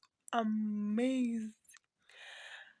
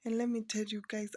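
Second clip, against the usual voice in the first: normal talking voice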